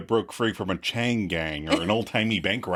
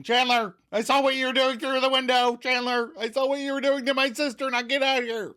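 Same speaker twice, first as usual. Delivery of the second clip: mock angry shout; 13 semitones higher than usual. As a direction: shout through the gap in the door.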